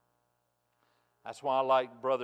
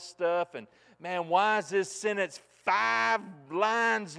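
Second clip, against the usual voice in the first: loud, high, driving sermon voice; full-force preaching; 6 semitones higher, 7 dB louder than usual. Acted. Pastor stirring his congregation about Greek emphasis, full-force preaching. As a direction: preach it hard and climbing to the congregation.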